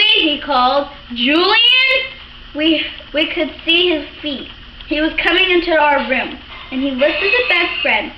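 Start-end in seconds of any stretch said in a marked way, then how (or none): 1.1-2.0 calling out. A narrator's reading. Father calling for hidden boys.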